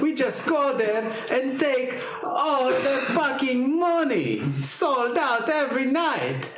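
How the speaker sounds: high, excited hammed-up Italian accent; mock Italian accent. A bandmate fantasizing about America, in a hammed-up Italian accent. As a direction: ham up an Italian musician dreaming of America.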